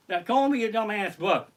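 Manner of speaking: ordinary talk, nothing marked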